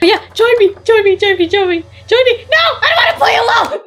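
high frantic pleading; pleading whine. Real, not acted. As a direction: beg them desperately to join. Begging other players to join.

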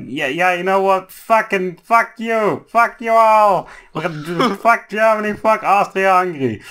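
playing France as a country gleefully cursing everyone; mock fired-up France. Friends retelling World War One, France hammed up, high and laughing. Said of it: "and France was like".

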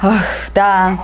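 ordinary delivery

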